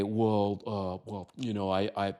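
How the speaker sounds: mock fumbling stammer; hesitant mumble. Mocking the weak answer, hesitant stammer. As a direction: stall and stumble over words. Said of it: "You don't say".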